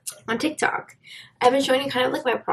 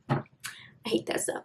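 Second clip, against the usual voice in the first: whispered aside; 11 dB quieter than usual. Real, not acted. Annoyed aside under breath.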